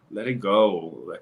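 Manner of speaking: a slow, sighing "Let it go", drawn out; weary sigh. Retold or imitated. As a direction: exhale it like you're tired of them.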